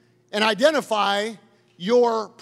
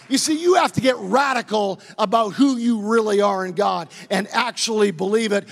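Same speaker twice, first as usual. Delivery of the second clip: ordinary delivery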